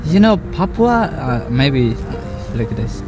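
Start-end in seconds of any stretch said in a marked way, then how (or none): none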